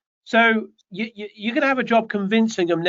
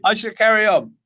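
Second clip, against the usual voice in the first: laughing; 3 semitones higher, 4 dB louder than usual. Real, not acted. Laughs after joke about hair.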